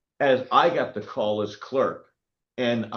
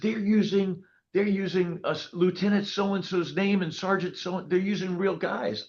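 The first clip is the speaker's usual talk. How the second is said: high, rushed outrage at the scammers; indignant excitement; 9 semitones higher than usual. Retold or imitated. Clerk telling the sheriff the scammers use real officers' names, high and indignant. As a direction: blurt it out, worked up and high-pitched.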